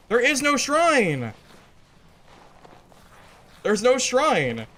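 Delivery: high, slow, drawn-out insistence; exasperated insisting. Real, not acted. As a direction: spell it out, fed up.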